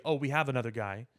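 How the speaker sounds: ordinary talk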